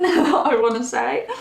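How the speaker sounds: embarrassed laugh spilling into the line. Real, not acted. Reader laughs at her own cheeky word, giggling. Laughs after saying moan.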